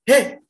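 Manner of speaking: loud high painful heh; harsh yell. Shown as a demonstration. Coach again demonstrating the painful unresonant shout. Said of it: "itu bisa sakit".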